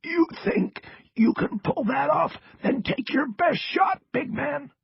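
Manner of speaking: strained, defiant rasping dare; strong rasp. A narrator's reading. Cross dares the threatening agent, strong rasp. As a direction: dare him in a forceful rasp. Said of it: "but then came back strong".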